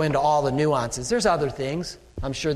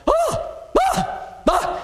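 high startled gasp, imitating a customer; shocked gasp; much slower than usual. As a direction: gasp in mock horror at the stains.

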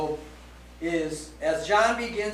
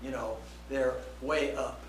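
usual speaking voice

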